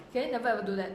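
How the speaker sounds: ordinary delivery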